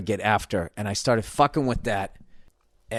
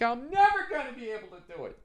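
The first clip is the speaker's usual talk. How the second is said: much higher, softer mock-whiny falsetto; whiny frustrated drummer; 14 semitones higher, 4 dB quieter than usual. Frustrated drummer failing at tempo, mocked in whiny falsetto. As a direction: whimper it like a defeated kid. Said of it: "you'd be like".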